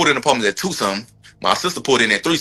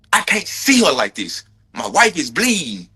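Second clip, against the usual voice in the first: imitating a panicked husband; 7 semitones higher than usual. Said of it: "what a real husband would've did".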